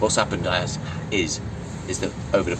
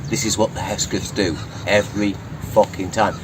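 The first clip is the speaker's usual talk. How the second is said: usual speaking voice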